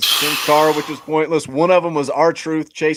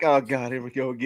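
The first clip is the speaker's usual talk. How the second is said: low, soft, weary sigh of a line; quiet mock exasperation; bored groan; 5 semitones lower, 8 dB quieter than usual. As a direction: sound utterly fed up with a boring act. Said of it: "it's like".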